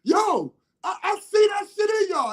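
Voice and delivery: high, loud angry outburst; furious, high-pitched retold tirade; cussing everybody out. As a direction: jump up and cuss out the whole room. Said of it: "I jumped up and cussed everybody out".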